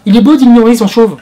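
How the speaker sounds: high, loud teasing gush; mock swooning. Reviewer mocking the actor's wig, loud teasing gush. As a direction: gush over him in jest.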